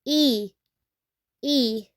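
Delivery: "E" as a pure ee vowel; Spanish letter name. Shown as a demonstration. Teacher repeating letter I in Spanish, "E" as ee. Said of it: "in Spanish".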